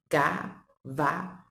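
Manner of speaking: slow, syllable by syllable cavalo; syllables drawn out. Shown as a demonstration. Teacher sounding out a word for the child, slowly. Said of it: "ela vai pensar assim".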